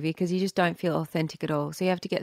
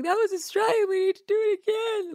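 much higher, wailing imitation of tearful protest; mock bawling; 12 semitones higher than usual. Actress re-enacting herself on set, mock bawling. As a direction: wail it as if crying, played for the story. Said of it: "I'm bawling my eyes out on set".

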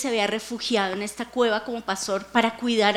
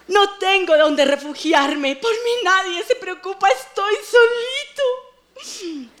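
higher, louder, hammed-up pleading; soap-opera drama; 6 semitones higher, 5 dB louder than usual. Preacher acts David's psalm as a melodrama, hammed-up. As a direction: play David like a telenovela hero. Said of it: "comienza David con el drama, telenovela mexicana".

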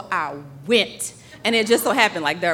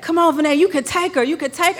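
higher, louder mock coaching call; imitating dad cheering; 7 semitones higher, 4 dB louder, faster than usual. Dad urging his daughter to pass the leader, mock cheer. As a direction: urge her on like a dad on the sidelines. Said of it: "I hear my dad".

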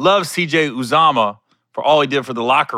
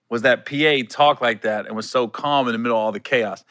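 ordinary talk, nothing marked

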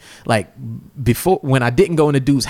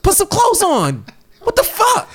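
high, incredulous outburst, retold; comic high-pitched protest; mock exasperated demand. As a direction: act out fed-up disbelief. Retells his side of the stalemate.

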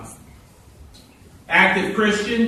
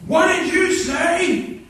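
indignant challenge; 13 semitones higher, 4 dB louder, slower than usual. Retold or imitated.

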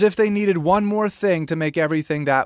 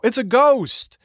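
a higher, louder frightened shout; mock terrified cry; 6 semitones higher, 3 dB louder, slower than usual. Disciples in the storm spotting a figure, frightened cry. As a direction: cry it out like a panicked fisherman. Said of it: "Jesus heard them cry out".

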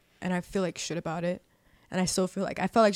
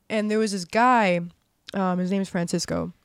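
normal talking voice

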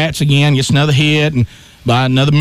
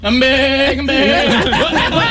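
put-on high, drawn-out child's chant; singsong high kid voice; kid's infield chatter; 9 semitones higher, much slower than usual. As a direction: mimic a little ballplayer chattering. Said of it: "he starts chattering like nothing happened".

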